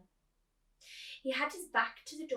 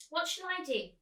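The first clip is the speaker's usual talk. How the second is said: put-on bored child; 9 semitones higher, 6 dB louder than usual.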